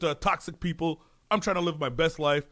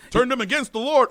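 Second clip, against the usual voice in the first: mock scandalized churchgoer; 7 semitones higher, 5 dB louder than usual. Voices church people blaming the woman.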